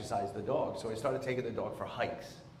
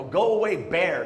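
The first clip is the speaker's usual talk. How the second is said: loud mock yell at a bear; 4 semitones higher, 8 dB louder, slower than usual. Reading the coyote sign, a loud mock yell at a bear. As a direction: shout off the bear, half joking. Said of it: "what happens if I yell".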